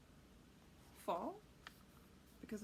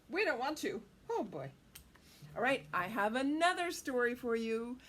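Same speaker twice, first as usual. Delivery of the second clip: usual speaking voice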